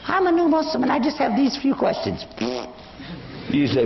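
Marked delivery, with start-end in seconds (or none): 0.1-2.2 high-pitched mock innocent voice; put-on, much higher voice of a feigned beginner. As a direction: mimic a pretender playing the harmless newcomer.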